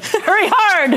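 curling skip yelling. Pretend. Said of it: "I was confusing it with curling".

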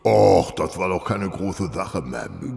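a slow, rough commoner's voice put on for the disguise; disguised working man. Holmes in disguise thanking the lady, slow commoner's voice. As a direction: play the humble simple man, unhurried.

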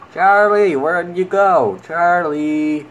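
loud, high call for a lost creature. Real, not acted. Player calling for the creature that swam away. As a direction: shout after Charlie as he vanishes.

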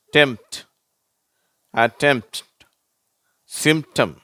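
"டெம்ப்ட்", "அட்டெம்ப்ட்", "சிம்டம்" with p left out; word list with pauses, m straight into t in "டெம்ப்ட்"; silent P. Shown as a demonstration. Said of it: "அந்த பீன்ற உச்சரிப்பு வரதில்லை".

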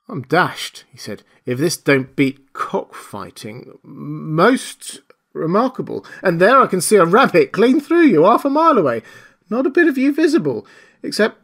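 high, excited rustic exclaiming; Marvel's astonished voice. A narrator's reading. Tramp Marvel marvels at the invisible man's voice, astonished. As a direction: gasp it out in wonder.

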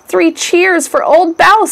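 put-on high, slow cheer for old Bouse; cheering shout. A narrator's reading. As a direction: call it out like a happy crowd member. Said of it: "someone said with a shout".

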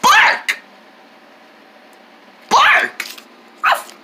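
high playful yapping barks; squeaky pretend barks with a laugh; pretend dog barking. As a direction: bark like a happy puppy. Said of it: "you say bark".

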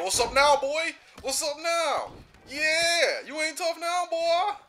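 high, loud gloating jeers; gloating taunt. Real, not acted. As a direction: rub it in the beaten opponent's face, high and loud. Taunting beaten opponent.

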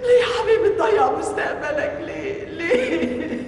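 mother sobbing over her son; crying. Acted. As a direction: cry over your son's lost future.

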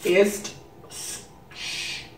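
slow, halting German read; sounding out German words syllable by syllable; attempted German. Shown as a demonstration. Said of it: "How was I?".

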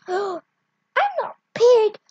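loud indignant retort; offended yell. Acted. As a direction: shout back, insulted.

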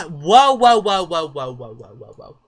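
loud, startled whoas; alarmed shout. Real, not acted. Player startled by the Sims getting carried away, alarmed. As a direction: blurt it out in alarm.

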